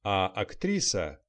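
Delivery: usual speaking voice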